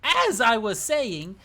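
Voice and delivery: hammed-up storybook narrator. Shown as a demonstration. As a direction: draw it out like a pompous narrator. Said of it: "a little, like, narrator voice".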